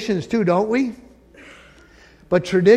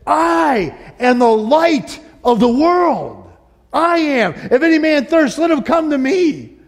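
high, louder ringing proclamation, slow start; 9 semitones higher, 4 dB louder, slower than usual. Retold or imitated. Jesus standing up at the festival, loud high proclamation. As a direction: call it out over the whole crowd. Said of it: "he yells with a loud voice".

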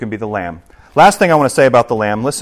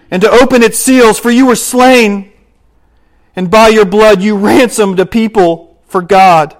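preaching scripture; 7 semitones higher, 7 dB louder than usual. Acted. Preacher reads the Lamb's worship to his church, preaching. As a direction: proclaim it over the congregation. Reads Revelation at full force.

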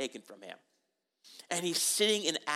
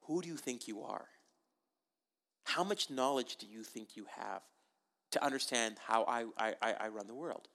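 God's stern reply; 6 semitones lower, 7 dB quieter than usual. Retold or imitated. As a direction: lean in low and quiet as God. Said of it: "God's like".

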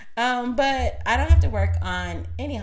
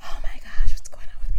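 hushed flustered whisper; 8 dB louder, faster than usual. Real, not acted. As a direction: whisper it to yourself, puzzled. Flustered aside about feeling hot.

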